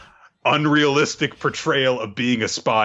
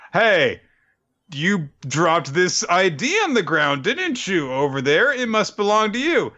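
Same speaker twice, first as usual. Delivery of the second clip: raised, friendly fake-teacher voice; high acted teacher voice; 9 semitones higher than usual. Recapping anime to friend, Lloyd as teacher, pitched up. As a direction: play innocent teacher, pitched up.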